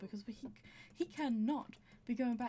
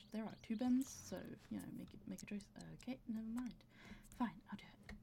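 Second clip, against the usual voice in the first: ordinary talk, nothing marked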